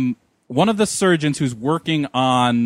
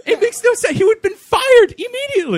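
very high, loud comic indignation; amused outrage, high and loud; exasperated disbelief; 6 dB louder than usual. Real, not acted. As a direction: protest at the absurdity, pitch shooting up. Joins outrage at the doctor.

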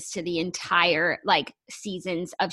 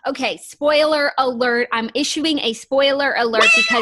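loud warning; 5 semitones higher, 6 dB louder than usual. Real, not acted. Host warning listeners before the reveal, loud. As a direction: call out the spoiler alert.

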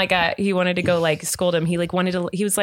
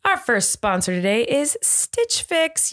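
slow, deliberately drawn-out sponsor read; slow beat talk; slower than usual. Shown as a demonstration. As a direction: speak it slowly to an imagined beat, half-joking. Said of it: "I will speak to the beat slowly".

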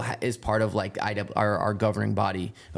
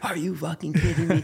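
dad's joyful yell; 7 semitones higher than usual. Retold or imitated. Dad shouting back at his son after the win, laughing. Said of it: "he's just yelling at me".

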